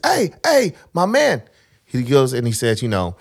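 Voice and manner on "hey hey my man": calling out. Retold or imitated. Vendor calling out on beach.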